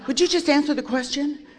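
a fed-up sponsor demanding an answer; exasperated. Retold or imitated. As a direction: cut through the excuses impatiently. Sponsor cuts off her excuses.

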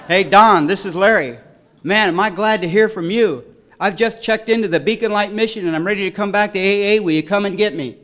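put-on loud, high, excited greeting to an old friend; eager phone call. Calling sponsor from mission, eager.

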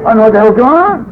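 high, loud, hurried; loud comic confusion; exasperated bewilderment. Retold or imitated. Comic bewilderment at crying room.

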